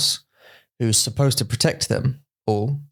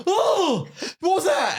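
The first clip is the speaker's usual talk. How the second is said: mock startled; slower than usual. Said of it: "You just go".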